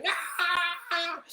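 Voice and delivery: high, softish mock squeal; small high-pitched wail; little spider's flailing. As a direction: imitate a tiny spider flailing helplessly. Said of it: "his legs going".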